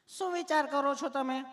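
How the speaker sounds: high put-on nurse voice; sweetly asking nurse. Nurse asking why he is nervous, high mock sweetness. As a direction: coo questions up high at the patient. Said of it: "તમે કેટલું પ્રેમથી પૂછો છો".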